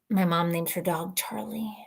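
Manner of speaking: hushed confession; soft secret aside; whispered aside. Real, not acted. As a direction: whisper the punchline.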